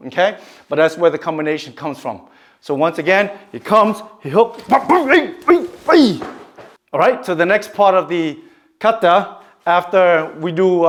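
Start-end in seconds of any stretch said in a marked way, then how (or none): 4.7-6.2 sharp, high kiai yells on each strike; kiai shouts. Shown as a demonstration. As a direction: shout out with every blow like in sparring. Karate demo with kiai shouts.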